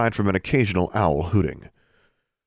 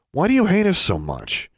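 high, exasperated question; demanding; 12 semitones higher than usual. A narrator's reading. Girl demanding why the boy hates her, exasperated. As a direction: demand an answer, pitch pushed up. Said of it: "she demanded".

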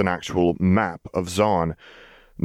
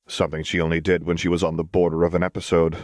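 usual speaking voice

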